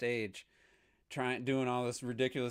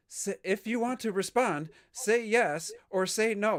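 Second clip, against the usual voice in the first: high, slow prompt voice; a robotic voice asking for yes or no; imitating automated female voice; 9 semitones higher, 5 dB louder, slower than usual. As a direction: mimic a stilted game prompt. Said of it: "female voice comes on".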